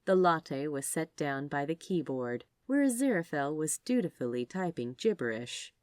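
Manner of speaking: ordinary delivery, nothing marked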